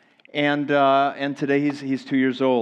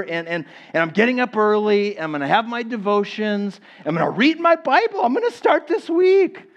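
mock-heroic resolve, climbing much higher; determined; 5 semitones higher than usual. Preacher playing someone vowing to get up early, building mock determination. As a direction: build to a high-pitched, fired-up vow. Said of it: "with all of our determination".

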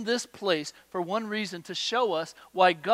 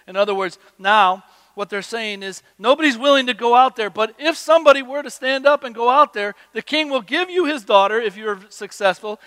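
louder, climbing preacher's build; full-force preaching; 6 semitones higher, 12 dB louder than usual. Acted. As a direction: sell the reward to the crowd, louder each line.

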